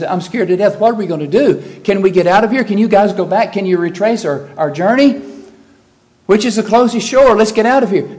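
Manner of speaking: high, loud panicky pleading; imagine a mock panic, urgent and loud; panicked. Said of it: "Jesus is wringing his hands. He turns pale".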